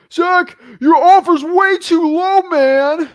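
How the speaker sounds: very high, loud, drawn-out protest; hammed-up hollered objection; mock outraged shout. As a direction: yell it like an exaggerated angry seller. Acts out seller's objection.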